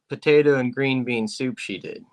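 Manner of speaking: normal talking voice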